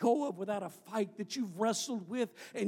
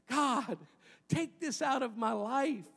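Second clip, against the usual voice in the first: raised, pleading voice of Paul begging God; pleading prayer; 8 semitones higher than usual. Retold or imitated. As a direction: beg God to take it away. Paul begs God to heal him.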